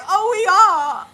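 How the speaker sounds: high, loud, stretched-out mock surprise; shocked realization. Retelling friend's realization of the chase, surprised. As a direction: draw it out as it dawns on you.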